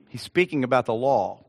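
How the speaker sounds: normal talking voice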